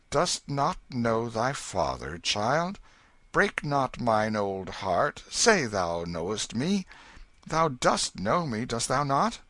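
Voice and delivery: put-on old king pleading with his son; pleading father king. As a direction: beg him to know you.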